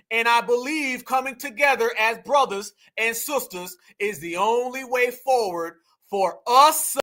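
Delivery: normal talking voice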